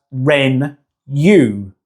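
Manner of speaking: renew broken slowly into ren-you, n and y apart; wrongly split syllables. Shown as a demonstration. Teacher showing how nobody pronounces renew, wrongly split syllables. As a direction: say ren-you as two separate chunks. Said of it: "We would never pronounce renew as".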